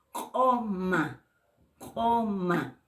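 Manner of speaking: COMA opening on a popped throat q; glottalized Q. Shown as a demonstration. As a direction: pop the first sound of COMA far back in the throat. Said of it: "con el apóstrofo".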